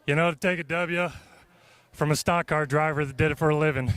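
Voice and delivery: ordinary talk, nothing marked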